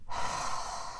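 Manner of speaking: long, soft breath out; gentle airy sigh to show breathing out; demonstrated exhale. Shown as a demonstration. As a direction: exhale slowly and audibly, no voice. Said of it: "So exhale".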